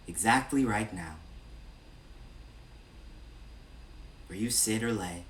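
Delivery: measured meditation-guide voice with long pauses between phrases; evenly projected, spacious meditation delivery; guided meditation. Real, not acted. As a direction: lead a body scan, unhurried and steady. Leads a body scan meditation.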